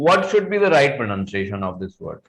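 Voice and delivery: usual speaking voice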